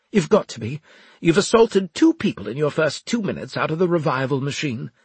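indignant officer. A narrator's reading. Officer to revived man who assaulted two people, indignant. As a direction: accuse him as an outraged superior. Voices indignant Winslade in story.

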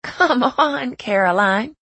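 everyday voice, a little Southern; casual protest in a mild Southern accent; slightly Southern accent. A narrator's reading. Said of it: "Emerson said in her regular voice, which was a little Southern".

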